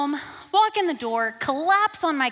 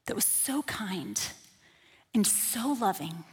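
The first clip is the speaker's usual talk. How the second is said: whispered, much softer; 5 semitones lower, 8 dB quieter than usual. Retold or imitated. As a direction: hush it, tender. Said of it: "this voice that did not sound like my own".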